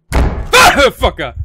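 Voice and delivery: real jump-scare scream, high and loud; startled yell. Real, not acted. Gamer startled by a loud noise in game, startled yell. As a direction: yelp and swear in shock.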